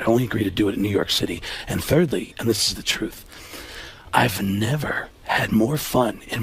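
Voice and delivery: low, earnest, measured actor; Alec Baldwin. Retold or imitated. Actor making peace with his rival, low earnest impression. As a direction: lay it out point by point, voice down. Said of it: "And he said".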